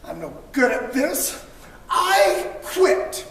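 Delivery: ordinary delivery, nothing marked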